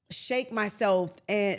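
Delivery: ordinary talk, nothing marked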